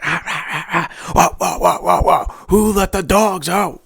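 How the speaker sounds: ridiculing them as a pack of barking dogs; mock barking and singing. Mocking opponents as barking dogs.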